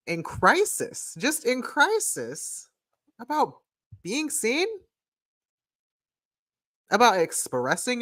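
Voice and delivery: lightly put-on high girly voice; playful high voice. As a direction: tease like a nosy bestie. Reading cards in put-on voice.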